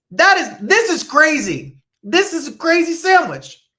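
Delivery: high, loud "this is crazy"; excited exclaiming. Real, not acted. Host tastes the jelly egg sandwich, loud and amazed.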